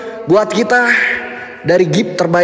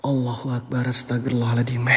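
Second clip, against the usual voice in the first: much softer, lower, murmured prayer; hushed prayer; 11 semitones lower, 12 dB quieter than usual. Real, not acted. Frightened streamer praying to himself, hushed. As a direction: pray under your breath, scared.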